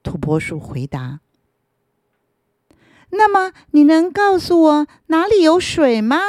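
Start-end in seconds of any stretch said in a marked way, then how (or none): 3.1-6.2 higher, louder hopeful plea; hopeful root. Acted. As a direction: ask eagerly where the water is. Root asks for water.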